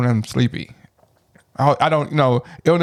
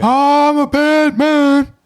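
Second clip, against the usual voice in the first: very high, louder, slow drawn-out mock Ali boast; Will Smith as Ali; 12 semitones higher, 5 dB louder, much slower than usual. Roasting Will Smith's Ali accent, slow, high and loud. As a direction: stretch it out in a hammed-up Ali accent. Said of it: "talking at the back of his neck".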